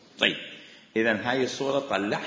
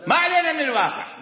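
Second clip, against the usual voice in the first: laughing the phrase out, higher and louder; 13 semitones higher, 6 dB louder than usual. Real, not acted. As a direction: say it through a real laugh. Laughs while repeating the phrase. (بضحكة حقيقية)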